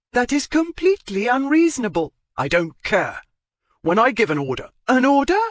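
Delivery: high, loud indignant back-and-forth; heated quarrel. Acted.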